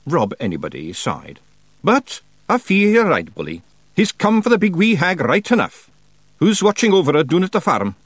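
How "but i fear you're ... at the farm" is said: high, loud Scots Feegle voice; Feegle leader Rob. A narrator's reading. Rob answers Daft Woolly about the hero's guard, high Scots voice. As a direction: pitch it up as a rough-and-ready Scots chieftain.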